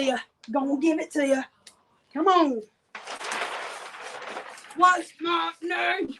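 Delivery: slow, drawn-out shouted rap call. Retold or imitated. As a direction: bellow it like the rapper hyping a crowd. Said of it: "that's my D M X impression".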